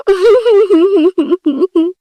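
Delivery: laughing while pretending to cry; half-sob half-giggle, loud; fake crying laugh. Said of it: "मैं तो बहुत दुखी हूँ". (रोने का नाटक करते हुए हँसी)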